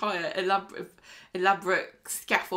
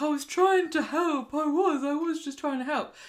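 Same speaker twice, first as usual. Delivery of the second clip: high mock-crying plea, voice cracking; arrested Ryan pleading as he's led away, hammed-up crying; mock sobbing; 7 semitones higher than usual. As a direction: pretend to sob out the excuse. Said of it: "he's like".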